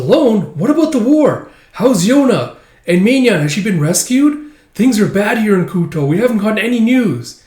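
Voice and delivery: high, loud rush of worried questions; anxious questioning. Acted. Girl floods Zeno with worried questions, high and loud. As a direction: pour out every worry at once.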